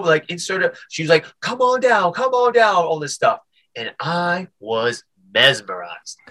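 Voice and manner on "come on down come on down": high, sing-out vendor cry; market seller's call. Retold or imitated. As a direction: beckon a crowd over the headset. Seller calling to market crowd.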